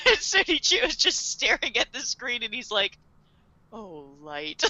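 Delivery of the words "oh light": low, hushed fatherly sigh of a line; deeply disappointed. Retold or imitated. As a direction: imitate a crushed dad, very softly. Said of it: "so disappointed".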